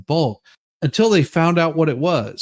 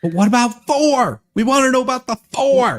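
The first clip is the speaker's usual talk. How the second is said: louder, pressing demand; loud, fast, insistent; 6 semitones higher, 4 dB louder than usual. Real, not acted. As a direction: push hard on the point. Pressing argument about four.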